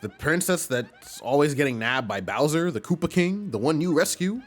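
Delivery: a hint of a chatty student, pitch climbing in disbelief; lightly voiced amazed student. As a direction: question the hero as an astonished student. Voices astonished Goombella.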